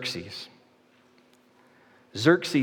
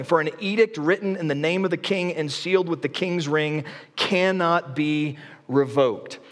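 scripture reading; 4 semitones higher than usual. Acted. Preacher reads scripture aloud.

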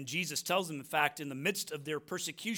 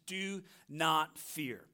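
each word slow and deliberate; slow, weighty scripture command; slow emphatic command; much slower than usual. Acted. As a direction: lay down "Do not fear." slowly, word by word. Repeating the verse's command.

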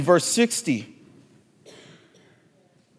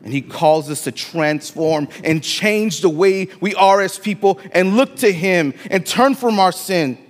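usual speaking voice

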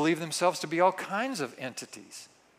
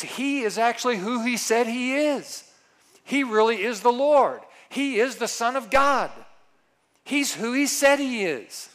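louder, higher, firm declaration; emphatic conviction; 6 semitones higher, 5 dB louder than usual. Acted. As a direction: proclaim it with conviction. Third option, emphatic conviction.

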